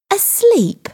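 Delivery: high, incredulous outburst without much volume; indignant shout. A narrator's reading. Girl throws the sergeant's suggestion back, mild shout. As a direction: exclaim it in high-pitched disbelief. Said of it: "shouted Linda".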